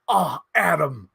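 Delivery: slow, drawn-out "Oh, Adam"; lightly voiced big guy in drag; relieved thug in drag. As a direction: sigh the name slowly with relief. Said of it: "the fat guy's like".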